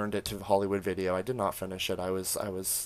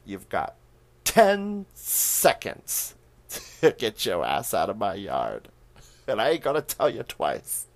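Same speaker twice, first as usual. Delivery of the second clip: hammed-up old woman threat; 8 dB louder than usual. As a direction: drag out the countdown like a gun-toting granny. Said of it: "The performance she gives here".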